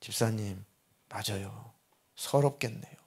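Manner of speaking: soft, low, consoling reply; gently commiserate, a touch of welling emotion; hushed empathy, voice sinking low; retold choked-up sympathy. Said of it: "저도 울컥하면서".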